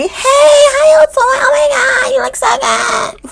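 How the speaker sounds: shrill, slow, fake-excited hello shriek; mock the fake friends with an overblown high squeal; hammed-up imitation of a two-faced welcome; phony greeting. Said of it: "Phony ass people".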